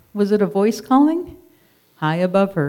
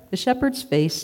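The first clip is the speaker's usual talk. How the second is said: usual speaking voice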